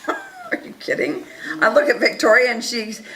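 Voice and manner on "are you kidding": laughing disbelief, much higher and softer; incredulous laugh. Real, not acted.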